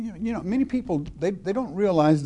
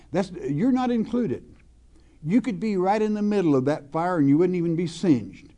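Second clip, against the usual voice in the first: ordinary talk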